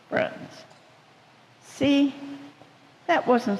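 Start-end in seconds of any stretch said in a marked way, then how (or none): 0.1-0.6 soft whispered reply. Acted. Flamingo accepting Potato's apology, whispered. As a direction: breathe it out as a whisper. Friends make up softly.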